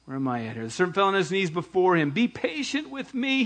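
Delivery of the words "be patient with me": begging. A narrator's reading. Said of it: "he begged".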